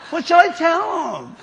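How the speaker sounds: high, whining complaint; whiny Moses. Retold or imitated. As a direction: whine it like a reluctant leader. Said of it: "in a whiny voice".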